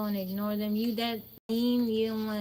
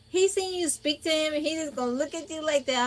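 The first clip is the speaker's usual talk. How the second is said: sister's indignation; 6 semitones higher, 3 dB louder than usual. Retold or imitated. Sister's indignant words voiced.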